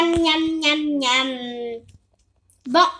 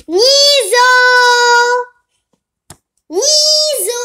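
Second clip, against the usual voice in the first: calling out; 6 semitones higher, 10 dB louder, much slower than usual. Pretend. Mother calling her son.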